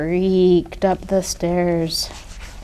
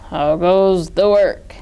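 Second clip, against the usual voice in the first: farmer's voice; 2 semitones lower, slower than usual. A narrator's reading. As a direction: echo the farmer's old call. Farmer voiced in read story.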